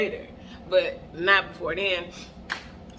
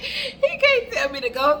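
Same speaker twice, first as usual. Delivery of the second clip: higher, laughing it out in disbelief; 7 semitones higher, 3 dB louder than usual. Real, not acted. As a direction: say it through real laughter. Repeated through laughter.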